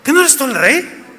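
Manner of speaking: high, mocking, pointed wife's voice; Jezabel's taunt again. Shown as a demonstration. Preacher replays the wife's goading, high and mocking. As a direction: lean into the taunt, high and needling. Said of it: "A ver".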